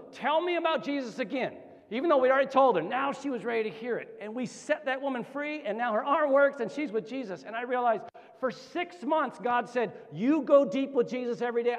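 high, driving preacher's climax; fervent preaching. Real, not acted. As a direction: preach it with rising fervor.

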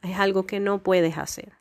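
ordinary talk

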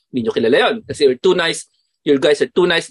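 usual speaking voice

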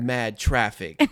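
loud hammed-up New York accent. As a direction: bark it like a cabbie. Keeps doing New York accent.

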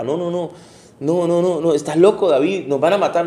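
protesta agitada, más fuerte y aguda; alarma actuada. Acted. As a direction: negarse con urgencia, imitando a unos soldados alarmados. David's alarmed men refuse his request. (protesta alarmada)

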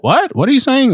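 high, baffled mock stranger straining to hear; puzzled stranger. Masked shopper imitating confused stranger, high baffled. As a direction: play someone who can't make you out behind a mask. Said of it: "they're like".

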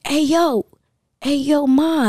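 high, loud "hey yo" catcall; mock street catcall. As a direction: call out like a creep at a girl on the street. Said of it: "he's like".

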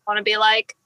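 normal talking voice

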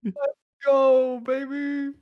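high loud hyped cheer; frat bro cheer. Acted.

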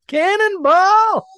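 long high drawn-out yell; cannonball yell. Pretend. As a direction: belt it like jumping in a pool. Yelling cannonball as a joke.

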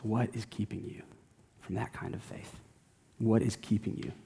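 soft, dropping into a whisper at the end; hushed searching question. Acted. Pastor quietly presses the congregation, fading to a whisper. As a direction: ask it gently, almost breathed.

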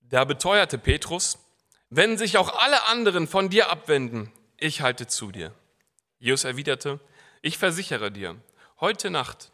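dramatic scripture reading; Bible reading. Acted. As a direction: read the passage to the congregation with feeling. Reads Mark 14 aloud. (dramatische Bibellesung)

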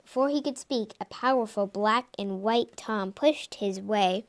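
ordinary delivery

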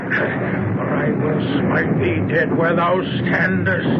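high, slow, archaic threats from a man gone mad; possessed ranting madman. Acted. Possessed son threatens his family, in high slow ranting. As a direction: declaim it like a possessed prophet.

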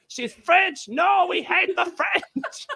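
hammed-up indignant yell; mock shouty voice. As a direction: play an outraged patriot. Voicing his body part.

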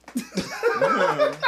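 high rising laugh bursting out; sudden high laugh; burst of laughter. Real, not acted. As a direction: crack up at the noise. Laughing at distracting noise.

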